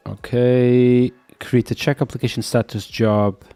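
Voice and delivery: ordinary talk, nothing marked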